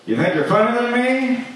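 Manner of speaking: a hammed-up high-pitched challenge; shrill-pitched repeat. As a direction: push the same taunt way up in pitch. Repeat much higher.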